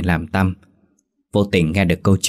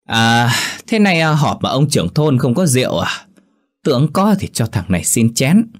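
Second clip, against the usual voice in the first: higher drunk slurring voice, mocking the elders; drunken slur; 6 semitones higher than usual. A narrator's reading. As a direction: play a cocky drunk sneering at the village. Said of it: "Ngồi ngất ngứ".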